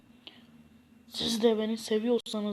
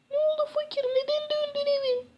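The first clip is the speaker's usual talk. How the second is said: high, sweet put-on wife voice; a loving wife seeing her husband off, much higher. Wife sends Fakir off to find work, high loving voice.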